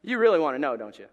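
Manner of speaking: ordinary delivery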